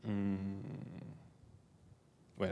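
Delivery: ordinary talk, nothing marked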